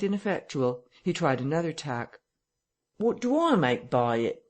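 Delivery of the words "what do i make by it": aggrieved. A narrator's reading. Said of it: "in a tone of unendurable wrong".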